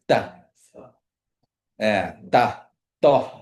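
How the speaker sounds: "da" said loud, tongue behind teeth; repeated "da" with the tongue stop; slow exaggerated speech modeling. Shown as a demonstration. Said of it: "to make".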